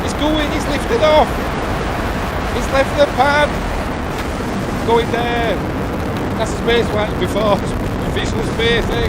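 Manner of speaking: high, loud, overwhelmed cheering; real excited shouting. Real, not acted. Spectator cheering rocket off the pad, excited shouting. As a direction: yell upward as if urging it on.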